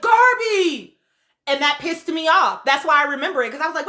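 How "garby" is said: high, loud shout of her name; retold yell. Host mimics him yelling at Garby, loud shout. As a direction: bark the name at her like he did. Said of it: "And he was like".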